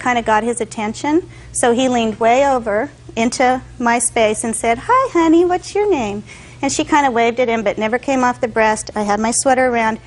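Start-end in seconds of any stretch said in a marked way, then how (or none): 4.9-6.2 put-on high, sweet baby talk from a stranger; cooing at a baby. Stranger leans over to greet the baby, cooing. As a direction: coo at the little girl like a friendly stranger.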